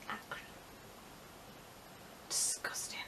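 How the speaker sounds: very soft whisper; whispered disgust. Real, not acted. Vlogger recalling first kiss, whispered disgust.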